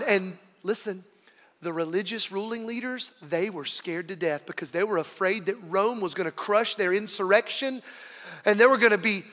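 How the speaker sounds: normal talking voice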